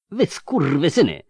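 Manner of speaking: high screamed curse. Acted. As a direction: spit the insult up at them. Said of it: "wrzasnął Agnus Bricri".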